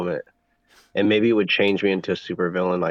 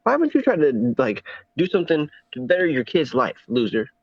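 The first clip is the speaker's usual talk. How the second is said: mocking high voice; 7 semitones higher than usual. Mocking impression, high pitch.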